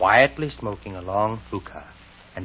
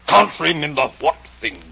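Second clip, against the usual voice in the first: loud, imperious caterpillar; 6 semitones higher, 8 dB louder than usual. Acted. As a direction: boom it out as a haughty caterpillar. Caterpillar voiced in story reading.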